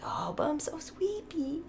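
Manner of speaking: high, slow whispered whine; drowsy high whisper, slowly; sleepy whisper. Retold or imitated. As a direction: whisper it like you're too sleepy to bother. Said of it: "It's like".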